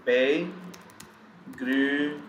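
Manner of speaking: Begrüßen pulled apart, each syllable very slow and held; syllable-by-syllable pronunciation. Shown as a demonstration. Teacher showing how we pronounce it, slow syllable by syllable. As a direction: sound out Begrüßen slowly in long separate syllables. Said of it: "We pronounce it this way".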